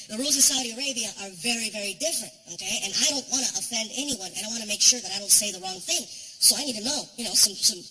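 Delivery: normal talking voice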